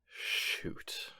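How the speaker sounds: breathy whispered shoot under the breath; a sharp whispered oath, caught in the act; whispered curse. Acted. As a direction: curse quietly so nobody hears. Caught off guard, swears under breath.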